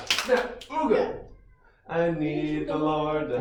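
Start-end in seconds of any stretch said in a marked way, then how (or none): 0.6-1.2 goofy high ooga honks; silly clown. Shown as a demonstration. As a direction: honk it out like a clown horn. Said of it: "fun song motions and sounds".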